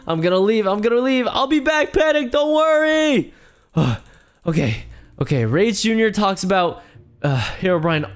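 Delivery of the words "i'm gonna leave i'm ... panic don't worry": frantic calling back. Acted. Runaway calling back to Panic as he leaves, frantic. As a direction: pitch climbing as he flees. Fleeing, calls back to friend.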